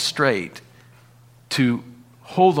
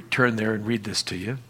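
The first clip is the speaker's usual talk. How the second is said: usual speaking voice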